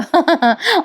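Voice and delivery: short light laugh; chuckle. Real, not acted. Narrator amused by own quip about the aunt, chuckling. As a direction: chuckle at your own joke.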